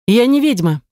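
curt retort. Acted. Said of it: "обрубила в ответ".

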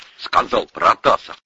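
usual speaking voice

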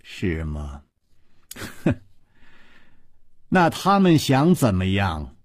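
cold sneer. A narrator's reading. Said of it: "水母阴姬冷笑着说". (低声冷笑，轻蔑地问)

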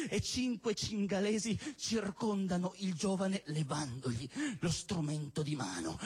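normal talking voice

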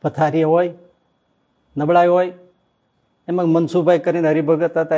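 normal talking voice

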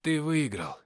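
effortful, laboured utterance; speaking with difficulty. A narrator's reading. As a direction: push the words out with effort. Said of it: "сказал я с трудом".

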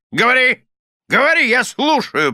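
high, forceful order; commanding. A narrator's reading. Husband ordering wife to recite, peremptory command. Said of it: "приказал мистер Корнер".

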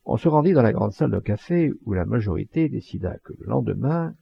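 plain reading voice

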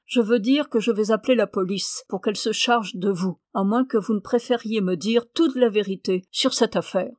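plain reading voice

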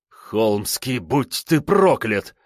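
slow hissing curse, powerless rage; sputtering foiled villain. A narrator's reading. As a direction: hiss the curse in helpless fury. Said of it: "в бессильной ярости зашипел Веперев".

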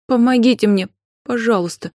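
high-pitched, urgent begging; pleading. A narrator's reading. Desperate client begging Anfisa for help, pleading. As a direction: beg for help, rising at the end. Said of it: "добавила с мольбой".

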